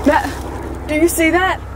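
breathless scared question; frightened. Real, not acted. Lights flash in the dark.

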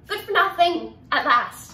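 loud, high burst of contempt; scornful outburst. Acted. Speaker railing at withering lives spent on errands, scornful outburst. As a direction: spit it out at full voice. Tirade against family servitude.

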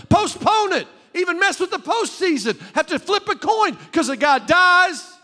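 high, loud, fervent pulpit cry; full-force preaching. Real, not acted. Preacher challenging church on what they live for, full-force preaching. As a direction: build to a shouted challenge.

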